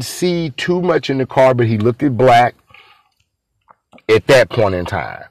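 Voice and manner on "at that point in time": ordinary talk, nothing marked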